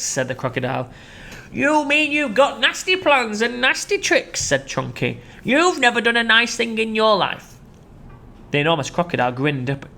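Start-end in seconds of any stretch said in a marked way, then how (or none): 1.5-7.5 higher disdainful voice; scornful elephant. Acted. Chunky scorning the crocodile. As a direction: sneer at his plans.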